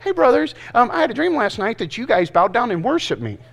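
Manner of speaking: hammed-up pretty boy bragging. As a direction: brag sweetly like the spoiled favorite. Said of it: "he was a little pretty boy".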